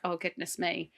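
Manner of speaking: very soft, low dismay; dismayed murmur. Retold or imitated. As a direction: breathe it out quietly, dismayed. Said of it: "And I'm like".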